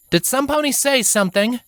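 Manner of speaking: high, curious character voice; voiced young Alicorn wondering aloud; puzzled heroine. A narrator's reading. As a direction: ask the empty room, puzzled.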